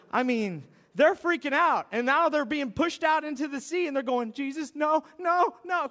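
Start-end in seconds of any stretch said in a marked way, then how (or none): 4.3-5.9 higher, louder desperate no no; panicked pleading. Retold or imitated. Disciples pushed out to sea, begging Jesus, mock panic. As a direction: plead as you drift away.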